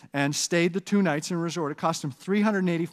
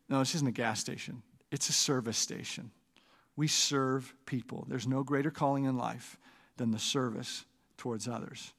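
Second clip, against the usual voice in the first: softer and lower, steady and patient; calm wise reply; 5 semitones lower, 8 dB quieter than usual. Retold or imitated. Guru correcting the arrogant boy, softer and lower. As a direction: answer gently from below, softer, like a mentor.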